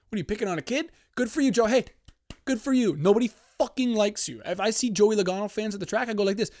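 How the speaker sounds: high, loud heated rant; worked-up rant. Real, not acted. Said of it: "Getting too hyped up".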